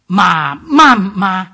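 "Ma, ma, ma" very loud, pitch jumping up midway; loud singsong tone demonstration; Mandarin tones. Shown as a demonstration. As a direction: give each "ma" its own exaggerated tone. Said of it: "if you put into these tones".

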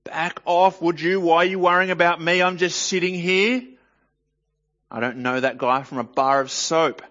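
cornered man snapping at a questioner; defensive snapping. Acted. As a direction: brush them off, rattled and annoyed. Peter's defensive denial acted.

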